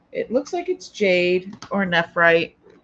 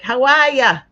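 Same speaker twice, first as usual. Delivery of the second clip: put-on a louder, playful take on Gigi's New York-style accent; mock local accent; 4 semitones higher, 8 dB louder than usual. Host greeting viewer Gigi, playfully copying her accent. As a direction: toss off a hammed-up local greeting. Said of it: "I love your accent".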